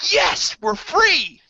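high, loud, drawn-out mock cheer; triumphant slow high holler; imitated jubilant kids. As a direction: yell it like kids set loose. Acts kids bursting out of door.

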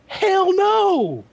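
high, mock incredulous yelp; mock outraged refusal. Telling friends how he turned Sam down, a high mock yelp. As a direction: squeal it like you can't believe what he asked. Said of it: "I was like".

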